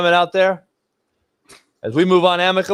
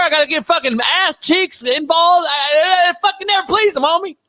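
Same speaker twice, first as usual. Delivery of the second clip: higher, shouting exasperated rant; frustrated rant; 10 semitones higher than usual. Retold or imitated. Man shouting at his never-satisfied girlfriend, exasperated yelling. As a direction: yell it like a fed-up man at wits' end.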